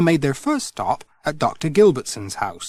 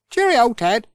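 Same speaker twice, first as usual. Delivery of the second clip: higher bright cheerio; cheery Pat; 11 semitones higher, 5 dB louder than usual. Acted. Pat says goodbye to Ted, high and cheery. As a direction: call it out brightly.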